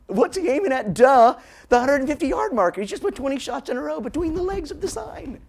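high, loud, goofy retort; mock incredulous onlooker. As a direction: scoff at the obvious in a put-on high voice. Acts out crowd answering the heckle.

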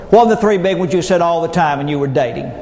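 ordinary talk, nothing marked

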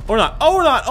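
loud, high, hurried repetition; panicked backtracking. Real, not acted. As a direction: yelp it as the plan falls apart. Panics after boasting.

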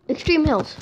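loud, sudden remembering; excited recall; eureka. Real, not acted. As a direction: blurt it out as it comes back. Remembers the biome name.

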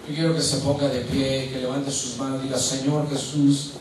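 rally the congregation to stand and pray; fervent altar call; preaching at full force. Acted. Calls congregation to prayer.

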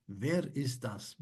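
Wer ist das said slowly and clearly; slow quiz question. Acted. Quiz host asks the contestant, slowly. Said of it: "diyor ki".

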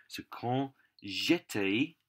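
usual speaking voice

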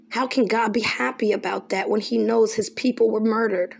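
ordinary delivery

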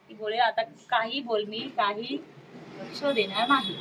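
ordinary talk, nothing marked

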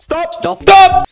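high, loud repeated yell; shouted stop. Acted. Guard warns about the train, escalating high shout. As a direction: build to a piercing shout. Guard's warning turns into yelling.

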